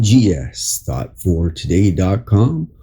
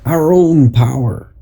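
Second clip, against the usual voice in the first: inspirational reading; 4 semitones higher than usual. Acted. Reads an inspirational article aloud.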